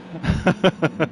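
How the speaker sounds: short laugh at his own accent; amused chuckle. Real, not acted. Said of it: "I don't know what accent that was".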